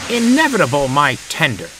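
high, loud outburst of disbelief; indignant scoff. A narrator's reading. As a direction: scoff loudly, pitched right up. James scoffs at Gordon's gloom.

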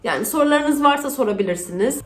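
usual speaking voice

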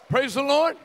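loud, high praise shout; joyful shout. Real, not acted. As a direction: cheer it out to the church. Pastor erupts after testimony video.

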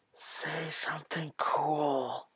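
slow, breathy stage whisper; hushed urging. Acted. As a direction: drag out a hushed prompt to a friend. Pod urges a friend to intimidate.

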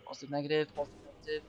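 soft mutter of Positive, negative under the breath; quiet muttered chant. Real, not acted. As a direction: murmur to yourself while solving. Muttering puzzle polarity.

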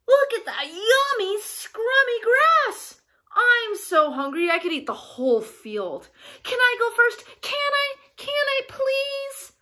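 high, loud, eager little-goat voice; excited hungry goat. Acted. Hungry goat begs to go first, excited and high.

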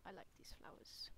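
soft whispered aside; whispering. Pretend. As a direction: breathe it out in a whisper. Whispered then squeaky repeat.

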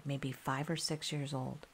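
ordinary delivery

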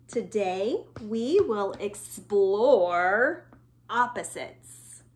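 put-on slow, deliberate read-aloud for children; reading aloud slowly. Shown as a demonstration. Teacher reading the board to kids, slow and deliberate. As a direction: read each word slowly and distinctly.

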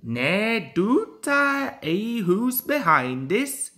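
slow, amused Scottish engine; knowing Scottish chuckle; Scottish accent. Acted. Donald laughing, Scottish.

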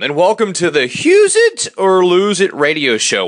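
radio host welcome; upbeat show host intro; announcer voice. Acted.

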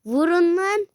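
loud, high rallying yell; shouted order. Real, not acted. Gamer yelling at his ducks to attack.

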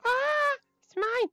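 high, loud cry of mine; snatch it back, shrieking; possessive squeal. Pretend. Grabbing item in game.

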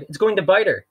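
high, loud pretend panic; boy faking alarm about the snake; faked alarm. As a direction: shout a warning you don't mean. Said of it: "Klaus cried".

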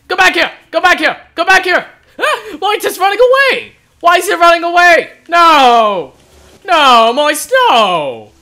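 high, loud, dismayed cries; distressed shouting. Real, not acted. Gamer yelling as the hornet runs away, distressed shouting. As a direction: shout after something that's escaping.

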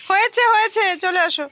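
high, loud call to come back; yelling across the room. Real, not acted. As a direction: call them over, insistent. Calling someone to come.

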